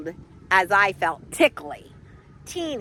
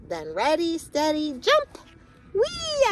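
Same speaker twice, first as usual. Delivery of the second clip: 1 building to a squeal; 7 semitones higher than usual. Acted. Acorn jumping from tree.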